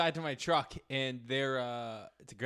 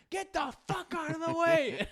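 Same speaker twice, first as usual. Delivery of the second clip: high mock yell of a furious driver; mimicking a Revere driver's high, raging scream; angry old guy; 14 semitones higher than usual. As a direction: imitate the Jeep driver screaming. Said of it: "he's just screaming at him".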